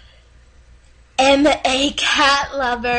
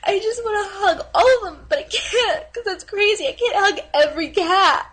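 higher, half-laughing half-crying gush; 4 semitones higher than usual. Acted. Dating video girl gushes about cats, laughing and crying. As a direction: sob it out while laughing at yourself. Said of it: "And she's starting to cry".

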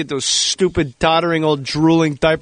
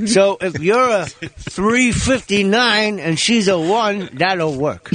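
higher, slow comedian impersonation; put-on voice, drawn out and raised; Buddy Hackett; 4 semitones higher, much slower than usual. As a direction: stretch it out like an old-school comic bit. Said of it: "as Buddy Hackett once told me".

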